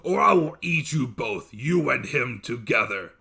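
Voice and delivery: loud, threatening monster growl; a giant ready to devour them; angry dev. A narrator's reading. Dev threatening his wife, loud and menacing. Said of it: "The dev replied with anger".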